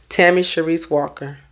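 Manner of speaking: ordinary talk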